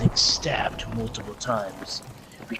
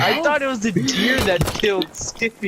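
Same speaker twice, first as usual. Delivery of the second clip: higher, louder, fast objection; exasperated protest; 7 semitones higher, 6 dB louder, faster than usual. Real, not acted. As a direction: stop them, they've gone too far. Protesting the story change.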